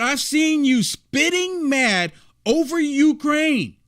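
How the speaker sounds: slow, pointed repeat of someone's jab; emphatic quoting. Retold or imitated. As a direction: stretch each word out to hammer the quote home.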